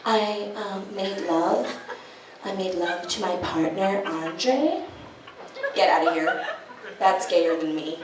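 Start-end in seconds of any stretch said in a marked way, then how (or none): 0.0-4.8 soft, prissy earnest confession; mock earnest romantic. People who say "made love", soft and prissy. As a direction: say it dreamy and precious.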